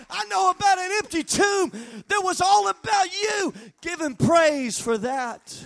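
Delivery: loud, high, driving preacher's cry; full-force preaching. Acted. Pastor rousing his congregation to praise, preaching at full force. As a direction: preach it hard to the back row.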